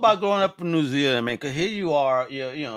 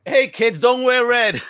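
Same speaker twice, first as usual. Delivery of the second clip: mock warning; 5 semitones higher, 6 dB louder, slower than usual. Joking warning to the schoolkids.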